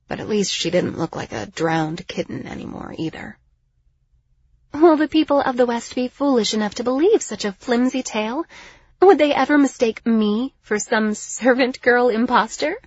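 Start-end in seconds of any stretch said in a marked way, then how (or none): 4.7-12.8 haughty, higher-pitched disbelief; lofty noblewoman scoffing at a silly notion; weirdly condescending. A narrator's reading. Liriana dismissing the flimsy tale, condescending. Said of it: "her weirdly condescending tone".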